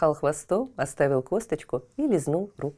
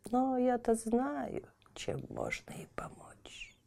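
put-on old woman whispering; 3 semitones higher, 4 dB quieter than usual.

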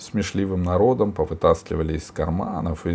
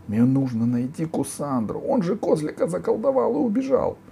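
put-on high, urgent girl's voice climbing higher; взволнованная мольба; 11 semitones higher than usual. A narrator's reading. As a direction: plead urgently in a high child's voice. Алиса взволнованно просит помочь. (взволнованно, высоким голосом)

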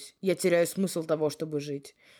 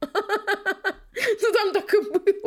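high, loud, laughing protest; 5 dB louder, much slower than usual. Real, not acted. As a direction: protest through giggles.

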